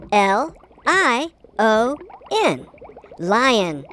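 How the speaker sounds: ordinary talk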